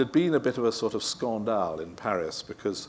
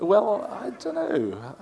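drawn-out musing; 11 semitones higher, 6 dB louder, much slower than usual. Real, not acted. Pondering the dog question.

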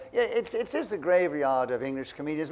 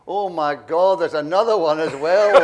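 a louder jeering call; mock heckle; 11 dB louder, slower than usual. Glasgow heckler groaning at the second brother, mild loud jeer. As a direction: jeer it from the stalls.

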